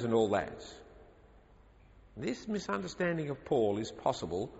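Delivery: usual speaking voice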